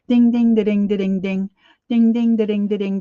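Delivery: high, rhythmic ding-da-ding chant; bouncy sing-song dinging, punched at the start; imitated commercial jingle. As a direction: mimic a tinny ad tune with dings. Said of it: "Then it goes".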